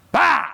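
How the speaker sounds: sudden high bark of "Bah!"; short, sharp, high mock bark; scare-back shout. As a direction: startle the dog with a yell. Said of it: "learn to do is say".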